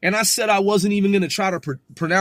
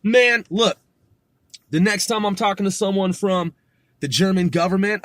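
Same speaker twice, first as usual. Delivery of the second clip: ordinary talk, nothing marked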